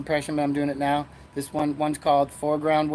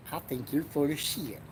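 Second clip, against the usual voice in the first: much softer, slurred I think you're full of shit; mumbled catchphrase; 9 dB quieter than usual. Acted. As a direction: toss it off, trailing into shit. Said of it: "But he says it like shit".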